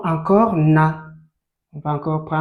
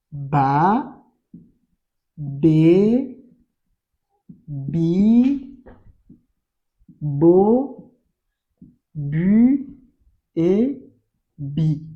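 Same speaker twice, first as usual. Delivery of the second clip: one syllable at a time, long pauses between; syllable drill; much slower than usual. Shown as a demonstration. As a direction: stretch each syllable like a reading drill. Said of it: "On avait dit qu'il y a". (syllabes récitées une à une, bien étirées)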